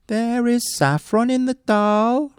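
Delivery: high, offhand woodman; casual remark. A narrator's reading. Woodman tempting the bear, high and offhand. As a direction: toss it off lightly, a sly lie. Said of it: "remarked the woodman casually".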